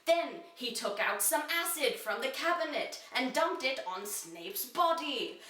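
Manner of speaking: ordinary delivery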